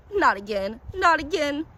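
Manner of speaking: rising wail of despair. A narrator's reading. Collector wails as the orchid vanishes again. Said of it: "the man wailed".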